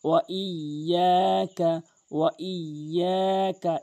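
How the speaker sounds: ওয়া ইয়াকা slow, ইয়া stretched; drawn ya. Shown as a demonstration. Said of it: "ইয়া অবশ্যই টানবেন". (ইয়া টেনে ধীরে)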